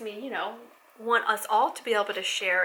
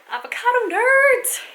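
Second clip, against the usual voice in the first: high, loud, drawn-out nerd cry; mock-triumphant holler; playful shout; 13 semitones higher, 11 dB louder, slower than usual. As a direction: belt it out slowly and high, playfully. Exuberant self-mocking geek cry.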